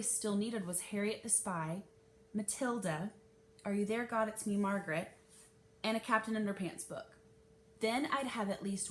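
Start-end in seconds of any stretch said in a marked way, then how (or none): none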